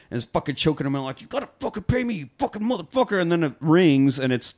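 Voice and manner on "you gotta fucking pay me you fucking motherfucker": high, strained mock rage; retold angry threat. Gangster choking a debtor, high imitated rage. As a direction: demand it as if throttling someone.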